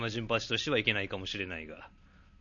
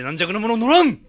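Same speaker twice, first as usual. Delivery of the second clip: loud scoff; 10 semitones higher, 12 dB louder than usual. Acted. Old man scoffs at taxis.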